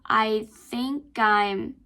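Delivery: I think I'm linked slowly; slow linking demo; linked pronunciation. Shown as a demonstration. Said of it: "you can say".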